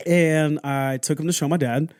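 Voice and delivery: usual speaking voice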